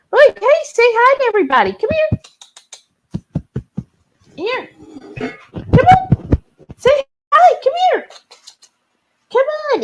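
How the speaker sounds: very high-pitched coaxing calls; coaxing pet call. Real, not acted. Calling pet to greet everybody, high coaxing.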